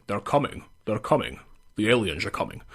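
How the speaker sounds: low, Scottish Connery voice, lightly done; lightly voiced Connery Scottish; Sean Connery. Shown as a demonstration. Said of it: "That was a bit Sean Connery".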